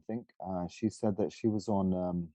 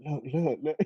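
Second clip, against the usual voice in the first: higher, eager "look, look, look"; mock excited; 7 semitones higher, 3 dB louder than usual. Friends pointing him out, eager. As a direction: friends showing off his accent to others. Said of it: "They'd be like".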